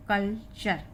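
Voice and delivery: "कल्चर" slowly as kal, then char; measured "कल्चर", ch opening the second syllable; syllables joined. Shown as a demonstration. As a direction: slowly blend the two parts of "कल्चर". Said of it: "ये हुआ कल और ये हुआ चर".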